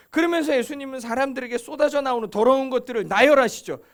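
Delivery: ordinary talk, nothing marked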